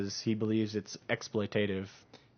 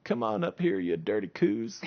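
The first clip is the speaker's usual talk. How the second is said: sleazy president; 3 semitones lower, 4 dB quieter than usual. Retold or imitated. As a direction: lure them up like a creepy politician. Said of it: "walk up to the podium like".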